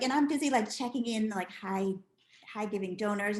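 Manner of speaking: normal talking voice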